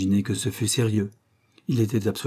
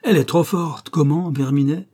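raised, high-pitched protest; indignant exclamation; 6 semitones higher, 4 dB louder than usual. A narrator's reading. Young client confronts the lying director, exclaiming indignantly. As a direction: burst out at him in outrage. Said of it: "s'écria-t-il".

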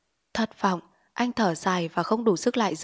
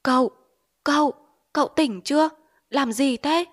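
higher, louder anxious call; alarmed calling; 6 semitones higher, 4 dB louder than usual. Acted. Wife calling her delirious husband, alarmed. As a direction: shake him awake with your voice. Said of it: "chạy lại đỡ gọi".

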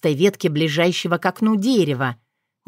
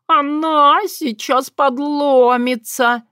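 high slow panicky wheeze; scared cat voice; 7 semitones higher, 3 dB louder, much slower than usual. A narrator's reading. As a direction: whimper it in terror. Terrified cat on branch.

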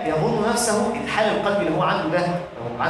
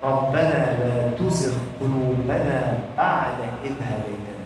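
recited supplication; 4 semitones lower, slower than usual. Acted. Preacher reciting the prayer of those firm in knowledge, slow supplication. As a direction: draw out the prayer and let it sink away. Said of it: "الراسخ في العلم هو اللي هيعلم الدعاء".

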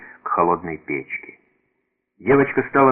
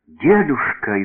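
frightened; 7 semitones higher, 3 dB louder, slower than usual. A narrator's reading. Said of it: "испуганно сказала девочка".

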